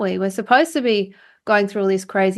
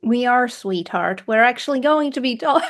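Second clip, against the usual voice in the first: playful mock Irish accent; 3 semitones higher than usual. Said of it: "You do it so well".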